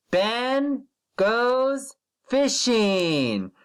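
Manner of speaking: slow, drawn-out word-by-word reading; slow model reading. Shown as a demonstration. Teacher slowly modelling a sentence for first graders. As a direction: read it slowly so the children can follow. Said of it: "Repeat after [name]".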